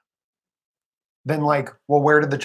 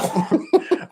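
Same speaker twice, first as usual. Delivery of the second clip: high laugh; 11 semitones higher than usual. Real, not acted. Laughs at Evan's claim.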